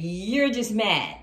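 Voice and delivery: loud, stretched-out jab; slow, loud taunting; drawn-out taunt. Acted. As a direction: draw it out loudly to needle him. Voices Empress taunting the Emperor.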